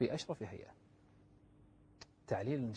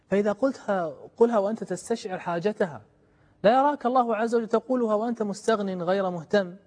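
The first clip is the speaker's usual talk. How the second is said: louder, higher urging from the pulpit; fervent preaching; 6 semitones higher, 7 dB louder than usual. Acted. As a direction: press the point home to the listeners.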